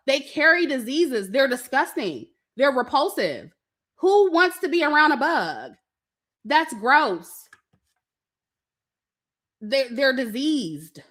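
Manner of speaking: ordinary delivery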